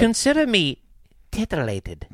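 hammed-up titillation; mock fascination. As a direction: play exaggerated interest, dropping at the end. Mock show of interest.

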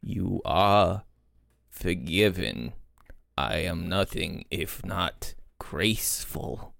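very low, soft, slow pardon; grandly magnanimous. Acted. Chester accepting an apology between bites, low, slow and grand. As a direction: pronounce forgiveness slowly, deep and hushed.

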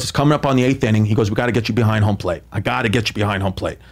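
low, fast dad scheming; dad's voice, quick. Retold or imitated. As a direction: rattle off the plan like the dad.

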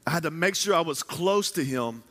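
ordinary talk, nothing marked

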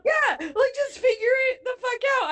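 high, fast, teary-laughing exasperation; exasperated outburst. Real, not acted. Co-host agreeing in a high, fast, exasperated outburst. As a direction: blurt it out, fed up. Exasperated rant about wedding.

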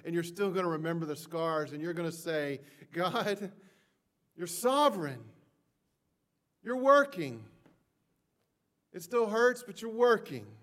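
impassioned sermon peak; preaching at full force. Acted. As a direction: preach it out, swelling with each phrase.

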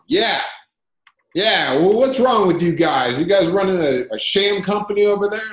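high, loud accusing customer voice; mock an irate caller attacking the company; angry customer. Irate caller accusing the founder of running a sham, loud and high.

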